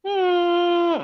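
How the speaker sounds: high whiny dog hum; dog whine. Retold or imitated. The dog at the window, high whimper. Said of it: "She'll sit in my window like".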